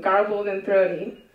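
usual speaking voice

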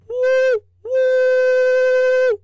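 very high, loud, long whistle; long, piercing "woo woo" toot; biggest train whistle. A narrator's reading. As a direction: hoot it out like a joyful locomotive. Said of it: "the biggest and best Charlie had ever whistled".

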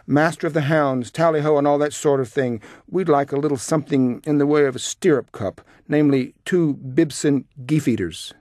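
high, loud, hearty hail; boisterous mock hunting-field summons; shouting. A narrator's reading. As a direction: call the order across the room. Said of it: "my father began to shout again".

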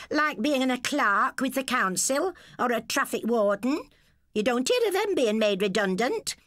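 high, sneering wife; scornful wife. A narrator's reading. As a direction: belittle the husband. Dora scorns husband.

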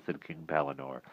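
ordinary delivery

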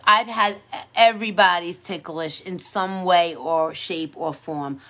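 normal talking voice

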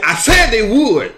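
loud, high, drawn-out insistence; forceful declaration. Retold or imitated. Preacher retelling the Lord's answer to the praying man, forceful. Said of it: "he said it forcefully".

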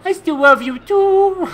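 high, slow, silly sing-out; exaggerated, drawn-out mock sweetness; hammed-up affection. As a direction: stretch it out high and playful.

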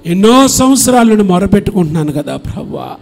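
loud, sung-out pastoral prayer; fervent prayer. Acted. Pastor praying loudly for the parents' children. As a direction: plead with God at full voice.

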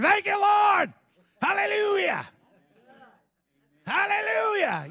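high, loud shout of thanks; ringing hallelujah shout; loud joyful praise. Retold or imitated. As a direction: shout it out to the Lord, full joy. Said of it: "It says he was loud".

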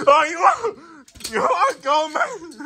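high squealing laughter through words; laughing. Real, not acted.

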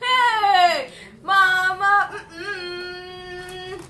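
high, loud, drawn-out whoop; long sung-out holler of joy; excited celebration. Real, not acted. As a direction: cry out in sing-song delight, then hum. Celebrating a big bid in live auction.

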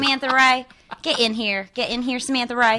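high Texan calling-out voice; Texas accent. Shown as a demonstration. Actress mimicking her friend from Texas, Texas accent. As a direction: imitate a Texan friend summoning someone. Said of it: "I have a friend from Texas".

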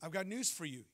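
ordinary delivery

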